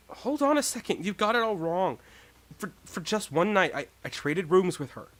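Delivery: lightly voiced accused boy pleading; defensive protest.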